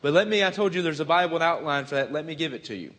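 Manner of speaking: ordinary talk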